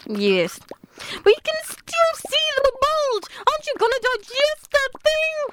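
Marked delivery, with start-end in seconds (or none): 1.2-5.5 indignant accusation. Acted. Accusing pet who ate mouse.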